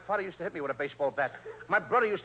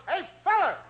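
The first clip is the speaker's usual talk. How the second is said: high-pitched holler to a player; hollered call; 10 semitones higher, 3 dB louder than usual. Acted. [name] imitating a manager calling his pitcher, hollering. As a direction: call out sharply to get his attention. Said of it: "he just hollers".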